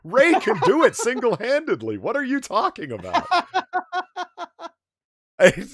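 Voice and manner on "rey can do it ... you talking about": high, loud objection; playfully indignant outburst; mock outraged protest. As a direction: protest loudly as a joke.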